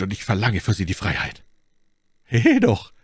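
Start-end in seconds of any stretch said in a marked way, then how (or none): 2.2-2.9 high, loud indignant call. A narrator's reading. Buckingham stopping Felton's demand, a loud high exclamation. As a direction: call him sharply to order. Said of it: "rief Buckingham".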